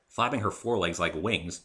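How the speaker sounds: ordinary delivery, nothing marked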